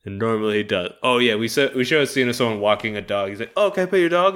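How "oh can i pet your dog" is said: high, sugary eager ask; mock eager ask. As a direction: chirp it up like a too-keen guy. Polite guy asks to pet.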